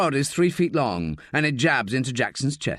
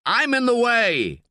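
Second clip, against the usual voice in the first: shouting; 11 semitones higher, 3 dB louder than usual. A narrator's reading. Jackson shouting mid-fight.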